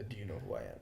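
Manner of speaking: low, soft quoted boast; understated mock boast. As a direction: toss off the quote low and quiet. Names a boast he won't use.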